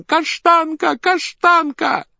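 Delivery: put-on very high, loud child's scream; piercing child's cry. A narrator's reading. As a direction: shriek for the dog like a child. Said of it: "пронзительным детским криком". (пронзительный детский крик)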